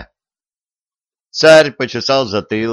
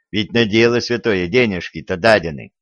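ordinary delivery, nothing marked

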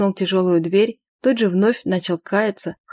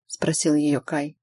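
ordinary delivery

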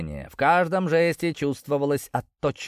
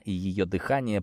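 ordinary delivery

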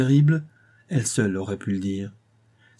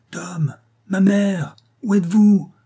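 slow, high, loud drawn-out call; exhausted piercing cry; 9 semitones higher, 5 dB louder, slower than usual. A narrator's reading. Maggie calling for Tom and mother over floodwater, exhausted piercing call. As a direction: call across the water, spent but piercing. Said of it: "d'une voix épuisée mais encore perçante".

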